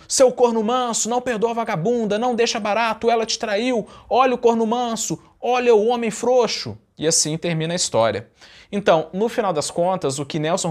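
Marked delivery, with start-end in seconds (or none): 0.1-6.8 high mock yells of scorn; furious crowd shouting. Mob taunts the forgiving husband, high mocking shout. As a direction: sneer like a bloodthirsty mob. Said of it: "o povo lá furioso gritando".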